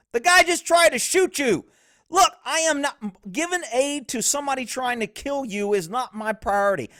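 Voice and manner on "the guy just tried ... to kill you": loud, high, indignant; heated rebuttal. Real, not acted. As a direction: push back hard. Heated defense of cops.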